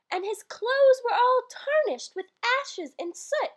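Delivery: slow, measured verse reading; poem recital. Acted. Reading the Christmas poem to listeners, measured recital. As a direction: lilt through the rhyme like a bedtime poem.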